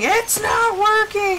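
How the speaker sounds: high, drawn-out mock whine; imitated whining. Wife imitating husband with broken computer, mock whine. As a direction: whine it like a frustrated husband, exaggerated. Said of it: "he'll whine and complain".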